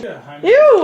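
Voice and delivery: high laughing ew; grossed-out shriek; disgusted squeal. Real, not acted. Toe on camera.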